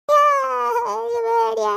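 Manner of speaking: loud, high frightened wail; child wailing in fear to her sister; scared crying. Acted. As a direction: cry out in fear. Girl still scared crying.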